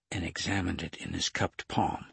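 ordinary delivery, nothing marked

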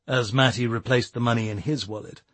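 ordinary delivery, nothing marked

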